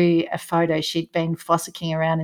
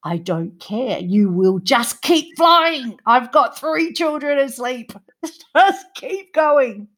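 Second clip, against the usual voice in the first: determined; 6 semitones higher than usual. Retold or imitated. Inner thoughts, urging plane.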